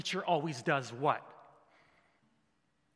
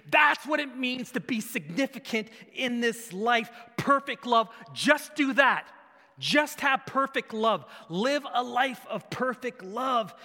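high, loud, jubilant shouting; Jesus celebrating the lawyer's answer, loud and exultant; excited cheer; 5 semitones higher, 6 dB louder than usual. Retold or imitated. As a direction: cheer like a touchdown was scored. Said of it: "Jesus goes".

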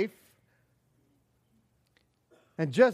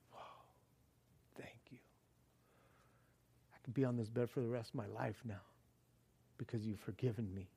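hushed gratitude; 9 semitones lower, 15 dB quieter, faster than usual. Retold or imitated. Grateful paralytic voiced.

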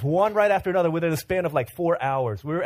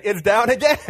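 amused friend's report; 8 semitones higher, 9 dB louder than usual. Retold or imitated. Friend points out zipper.

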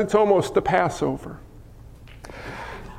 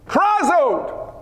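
crying out; 6 semitones higher than usual. Acted. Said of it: "Look at what he cried out".

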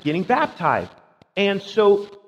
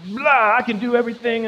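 higher, louder babbling brag; mock boasting; 6 semitones higher, 4 dB louder than usual. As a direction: ham up a know-it-all preacher. Mocking the pushy evangelist's boasting.

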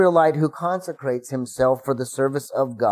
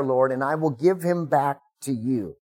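ordinary talk

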